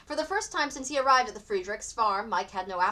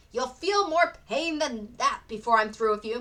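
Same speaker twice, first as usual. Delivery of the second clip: a hint of a menacing father, slow; lightly voiced stern threat; father threatens the boy, slowly; grunted threat; 3 semitones higher, 5 dB louder than usual. A narrator's reading. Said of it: "Mister Friedrich grunted".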